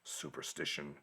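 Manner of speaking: very low, quiet, slow doom voice; ominous whisper. Retold or imitated. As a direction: intone it like a grim prophecy. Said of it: "Demetrios suddenly says".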